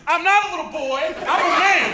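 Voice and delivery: high, indignant mock yell; retold yelling. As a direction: imitate yourself yelling at the end of a fight. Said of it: "was me yelling".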